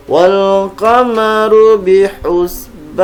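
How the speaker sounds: slow, careful Quran recitation; over enunciating. Shown as a demonstration. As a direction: chant each syllable deliberately. Recites verses, each twice.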